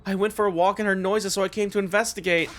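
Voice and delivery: put-on Nozomi's worried girl voice. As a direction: reach out in alarm. Voicing girls worried at night.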